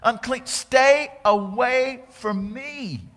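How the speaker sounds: loud, high, slow warning cry; leper calling out. Acted. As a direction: call out to warn people to stay back. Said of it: "they came upon somebody and go".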